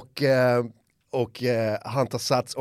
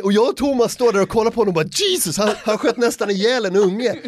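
shocked excitement; 8 semitones higher, 4 dB louder than usual. Retold or imitated. Telling shocked reaction.

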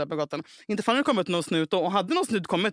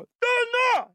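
high-pitched shouted command; imitating a yelled stop; mock shout. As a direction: shriek the order to halt. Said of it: "springande och skriker".

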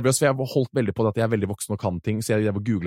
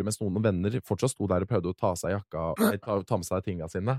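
normal talking voice

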